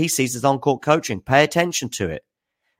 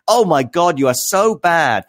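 higher, louder mock dismay; mock viewer heckle; 7 semitones higher, 5 dB louder than usual. Chat viewer mocking his acting, hammed-up jeer. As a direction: ham up a jeering viewer. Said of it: "my acting performance".

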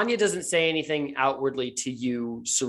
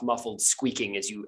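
normal talking voice

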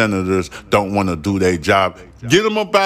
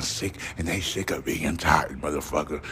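worn-down whisper; 7 semitones lower, 9 dB quieter than usual. Real, not acted. Host on the tired young generation, fading whisper. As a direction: sink to a low, soft whisper. Rant dropping to a growl.

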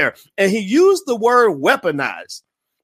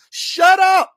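mock shout; 12 semitones higher, 5 dB louder than usual. Mocking slogan, telling self off.

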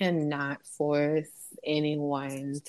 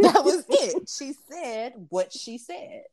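laughing repeat; 7 semitones higher than usual. Acted. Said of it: "repeats phrase, laughing".

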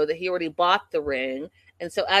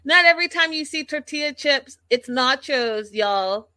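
exasperated correction; 7 semitones higher, 5 dB louder than usual. Real, not acted. Correcting Katie's nachos mix-up.

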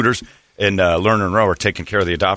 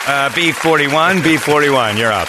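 mock bingo caller; 7 semitones higher, 4 dB louder, slower than usual. Mock bingo number calling.

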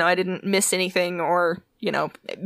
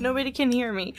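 normal talking voice

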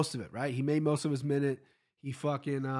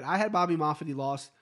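ordinary talk, nothing marked

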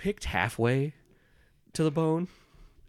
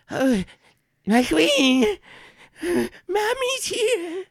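much higher, louder, slow fawning goblin shriek; Sneep Snap; 9 dB louder, much slower than usual. Acted. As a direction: squeal adoringly, stretch the last words. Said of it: "he goes".

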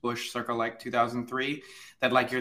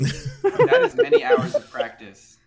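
high, slow laughing remark; 10 dB louder, slower than usual. Real, not acted. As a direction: laugh it out in delight. Amused reaction to impression.

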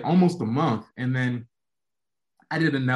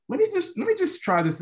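a sudden much higher "let me just try this"; eager try-it voice. Retold or imitated. To himself deciding to film, a high eager voice. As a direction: pitch it way up, half joking.

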